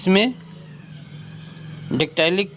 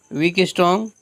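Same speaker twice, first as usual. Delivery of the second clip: normal talking voice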